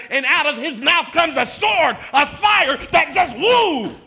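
loud, high, building to shouts; full-force preaching. Real, not acted. Preacher warning church of Jesus coming back, shouted fiery preaching. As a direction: build to a roaring pulpit climax.

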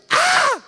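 very high, loud joyous shriek; mama's joyful scream. Retold or imitated. Mama seeing her saved son, screaming for joy. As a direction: scream like a mother whose prayers are answered. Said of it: "She screamed".

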